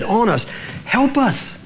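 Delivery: high, drawn-out cry for help. Acted. Father begging help for his boy, desperate high cry. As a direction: plead desperately at high pitch. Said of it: "the man cried out".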